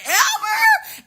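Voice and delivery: very high, mock-shocked squeal; incredulous question. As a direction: shoot way up in disbelief. America reacts to being cut off.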